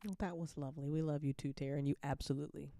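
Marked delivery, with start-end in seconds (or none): none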